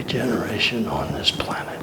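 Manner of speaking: reverent whispered aside. Acted. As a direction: let it fall to a hushed whisper.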